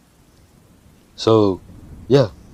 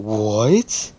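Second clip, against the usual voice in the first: loud, drawn-out baffled "what" with a rise; confused; 3 semitones higher, 5 dB louder than usual. Retold or imitated. Classmate bugging out at Deku's disappearance, confused. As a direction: exaggerate the bewilderment, swooping upward. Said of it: "They're straight up fucking confused".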